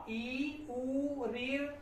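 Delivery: ordinary talk, nothing marked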